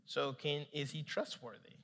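soft, low, calm question; gentle questioning. Retold or imitated. Holy Spirit asking Nick about his father, gentle. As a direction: ask quietly and patiently.

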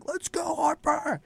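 high, cheering call at the TV. Retold or imitated. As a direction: yell it like a fan in a bar. Fans cheering at the TV.

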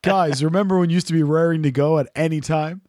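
ordinary delivery

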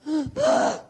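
sharp strangled gasp for air; choking gasp. Retold or imitated. Collapsing woman gasps for breath outside the restaurant, choking gasp. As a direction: gasp like she can't breathe. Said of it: "She's clutching her throat".

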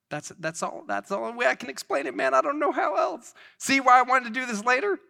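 high-pitched, loud, rushed self-quote; flustered exasperation. Retold or imitated. Acting out his own flustered explanation.

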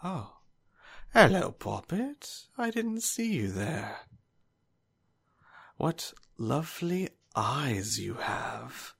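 high, slow, sing-song menace; creepy villain greeting. Acted. Old swordsman greets the sister with creepy sweetness. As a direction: purr sweetly at the sister like a predator. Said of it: "turns around and goes".

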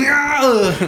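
very high hammed-up Schwarzenegger yell; Arnold Schwarzenegger shout. Host yells again as a mock Schwarzenegger. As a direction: bark it like Arnie. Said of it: "That was actually Arnold Schwarzenegger".

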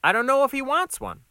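high, quick, rising objection; flustered waiter pushing back; exasperated protest. Acted. Waiter protests under pressure.

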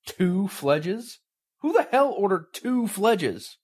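rising outrage, slowing down on the question; outraged. Acted. Boss furious over the order.

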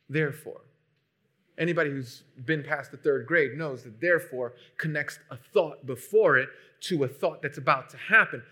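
ordinary delivery

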